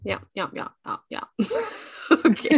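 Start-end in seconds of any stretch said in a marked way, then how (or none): 1.3-1.8 short high giggle; embarrassed laugh. Real, not acted. Host laughs after miscounting the hostages, a high giggle. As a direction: laugh at your own mistake.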